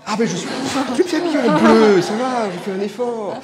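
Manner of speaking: amused, playful comeback; laughing reply. Real, not acted. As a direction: laugh off the jab with a grin. Laughing reply to a teasing remark.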